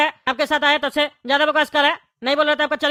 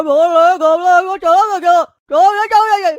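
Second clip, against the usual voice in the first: higher, louder and slower garbled chanting that fades at the end; mocking the babbler; 5 semitones higher, 5 dB louder, slower than usual. Traveller mimicking the garbled stranger after he leaves, high and slurred. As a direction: parrot the stranger's slurred words.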